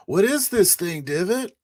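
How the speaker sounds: high, loud in-character question; called-out demand. Acted. Pilot dangling package before enemy, called-out demand. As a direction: call it up to the enemy. Player calls question to mech.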